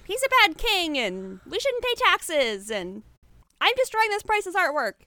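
hammy troublemaker rant; mock yelling.

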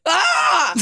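high mock scream; sudden high comic shriek; Marty screaming. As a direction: scream short and high in imitation. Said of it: "Like Marty screaming".

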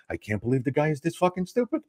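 hushed, incredulous aside; imitated whisper. Bronzy behind the comic at the bar, whispering in his ear. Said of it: "whispering in my ear".

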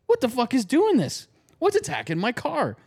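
lightly acted, high-pitched indignant owner; a touch of mock outrage; baffled car owner. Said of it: "She's like".

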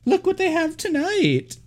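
very high, put-on gushing voice; mock resort host. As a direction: gush like a fake-cheery host showing off the show. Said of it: "just to be like".

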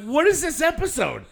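very high, loud incredulous cry; retold exasperated outburst. Viewer baffled by friends' hype, high exasperated yell. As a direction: blurt it out in mock exasperation.

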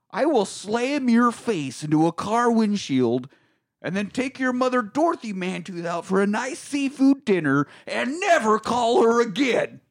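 high, slow, hammed-up angry rant; mock furious threat. Newsman threatening his rival in a retold movie face-off, mock fury. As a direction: build an over-the-top threat, punching each phrase.

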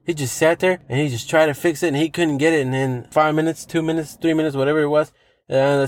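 normal talking voice

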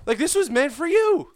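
high, loud and pointed; mock indignation. Comic scolding the friend who stole his line, high and indignant. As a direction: press it like an accusation.

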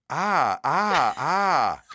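pained half-laughing cries; pained cry. Real, not acted. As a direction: cry out from the burn. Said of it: "That legit burns".

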